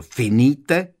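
loud, high quick command; forceful incantation; shouted spell. A narrator's reading. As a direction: cry out the counter-spell. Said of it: "křikl".

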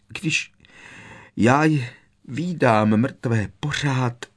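slow, broken words through sobs; weeping into his shoulder; hiccuping sobs. A narrator's reading. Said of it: "škytala mu do ramene". (vzlykavě, se škytáním)

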